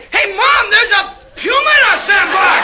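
put-on high excited kid shout; child voice. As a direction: call out like a scared kid. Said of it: "they say".

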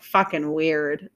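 ordinary delivery

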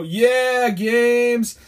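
high, loud chanted reply; mock crew cheer. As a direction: imitate an eager crowd shouting back. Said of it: "the crew would go".

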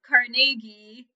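Carnegie stressed on the middle syllable, car-NAY-gee; second-syllable stress. Shown as a demonstration. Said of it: "in Scotland it's pronounced".

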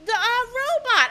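put-on high, slow little-kid answer; child's voice. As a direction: answer slowly like a small child. Daughter's reply imitated.

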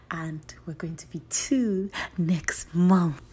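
slow secret whisper; excited whisper. Real, not acted. Revealing podcast anniversary.

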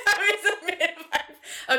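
words through laughter; laughing. Real, not acted. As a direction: laugh while talking. Laughing at the growing joke.

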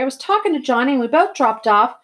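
anxious, defensive excuse; pleading. A narrator's reading. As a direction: plead your case to an angry brother. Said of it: "I pleaded with Derry".

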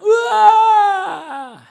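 huge high roaring yell; wild shout. Acted. Preacher acts out Esau's evening roar, full wild yell. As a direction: bellow it out like a wild hunter. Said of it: "Itu kalau dia teriak".

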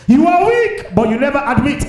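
high, loud shouted preaching; fiery preaching. Real, not acted. Pastor shouting rebukes at the congregation, fiery preaching. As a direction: hammer each line home to the congregation.

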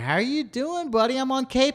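high-pitched mock caller voice; Sandbuck. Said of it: "he called me up and he's like".

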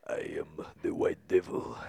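put-on low, menacing Russian accent. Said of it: "you had to do the Russian accent too".

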